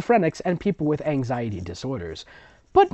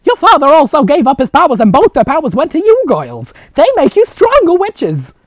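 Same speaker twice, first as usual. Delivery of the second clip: high, loud storytelling grandmother; 15 dB louder than usual. Acted. Grandmother explaining witch rules to the twins, high and loud.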